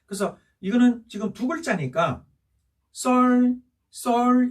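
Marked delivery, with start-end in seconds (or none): 2.9-4.5 "썰, 썰" as two equally high, level notes; double first tone. Shown as a demonstration. Teacher showing two first-tone syllables, "썰, 썰" high, level. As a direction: hold each "썰" high and unwavering. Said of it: "이런 톤이 되겠죠".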